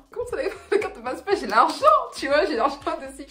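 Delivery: high, loud, half-laughing outrage; shocked it won't go through, laughing; laughing disbelief. Retold or imitated. At the toll booth her card is refused, laughing disbelief.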